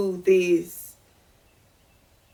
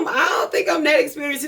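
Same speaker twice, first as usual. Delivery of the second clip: alarmed protest; 7 semitones higher than usual. Retold or imitated. Turning down the swinger offer.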